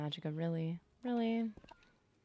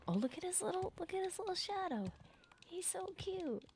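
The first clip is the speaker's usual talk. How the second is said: very high, cutesy cooing; 13 semitones higher, 4 dB quieter than usual. Real, not acted. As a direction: coo over something tiny and adorable. Cooing over a cute creature.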